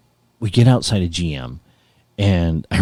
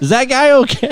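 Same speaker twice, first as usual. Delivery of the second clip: concerned guard; 4 dB louder than usual. Retold or imitated. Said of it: "She's like".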